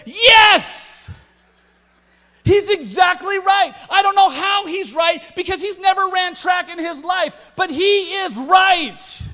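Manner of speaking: high, loud yell of yes; shouted agreement. Retold or imitated. Dad backing up his son from the driver's seat, excited shout. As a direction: cheer him on at full voice.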